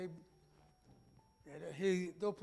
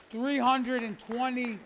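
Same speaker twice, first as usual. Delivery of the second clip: ordinary delivery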